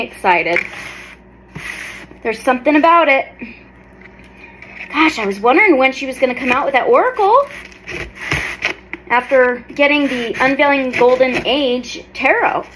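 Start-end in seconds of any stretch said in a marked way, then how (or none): none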